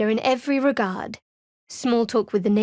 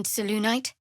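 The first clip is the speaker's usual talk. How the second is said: ordinary talk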